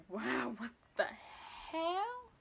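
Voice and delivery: drawn out, very slow disbelief; slow stunned wow. Retold or imitated. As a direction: stretch it out in stunned amazement. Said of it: "And I'm like".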